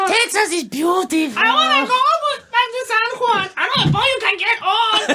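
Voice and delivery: lightly voiced old man, laughing; hammed-up old person voice. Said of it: "your old person voice".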